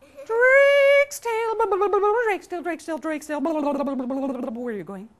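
burble the words like running water, sliding down in pitch; babbling river voice. Acted.

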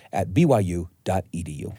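normal talking voice

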